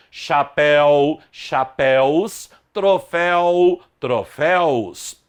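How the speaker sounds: slow, drawn-out "Chapéu, chapéus, troféu, troféus", word by word; ditado para memorizar. Shown as a demonstration. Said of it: "Há de se memorizar principalmente essas duas palavras". (palavras bem pausadas e alongadas, para memorizar)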